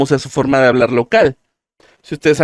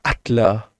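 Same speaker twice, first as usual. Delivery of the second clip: breathy atla; 4 semitones lower, 5 dB quieter than usual. Shown as a demonstration. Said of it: "como si se estuvieran quedando sin aliento".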